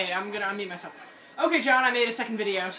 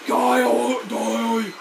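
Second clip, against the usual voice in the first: battle cry; 8 dB louder, much slower than usual. Retold or imitated. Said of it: "we're just like".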